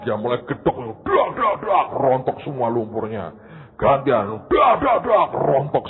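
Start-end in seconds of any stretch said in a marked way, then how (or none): 1.1-1.9 loud, higher knocking noises; knocking sound effect. Acted. Preacher imitating boots knocked to shake off mud. 4.5-5.3 loud, higher knocking noises; knocking sound effect. Acted. Preacher imitating boots knocked to shake off mud.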